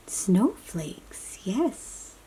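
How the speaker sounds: ordinary delivery, nothing marked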